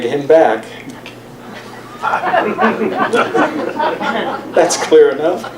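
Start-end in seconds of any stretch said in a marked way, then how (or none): 2.0-4.5 quiet breathy chuckle; soft laugh. Real, not acted. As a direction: laugh softly to yourself. Laughs after reading the title.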